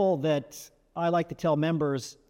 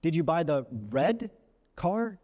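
"red" lifted and held, pause before "car"; stress on RED; slower than usual. Shown as a demonstration. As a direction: lean on "red", then pause. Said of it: "in several ways with several different meanings".

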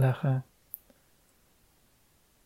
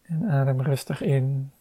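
usual speaking voice

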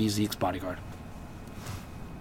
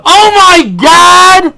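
high, slow, drawn-out cry of shock; sudden shocked shout; 16 dB louder, much slower than usual. Real, not acted. Host suddenly remembers the big news to cohost, shocked shout. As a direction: blurt it out like a sudden realization.

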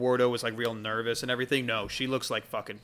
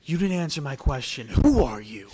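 menacing guard; 3 semitones higher, 7 dB louder than usual. Acted. Bori interrogates the player.

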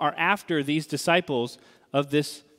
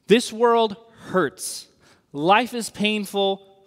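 usual speaking voice

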